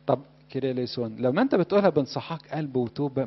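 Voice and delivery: ordinary talk, nothing marked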